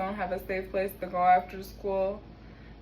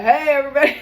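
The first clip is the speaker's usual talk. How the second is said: loud booming hey everybody; mock commanding shout; commanding call; 6 semitones higher, 11 dB louder, much faster than usual. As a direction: imitate the man over the walkie. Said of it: "super commanding, masculine, deep voice".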